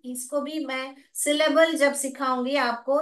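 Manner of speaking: normal talking voice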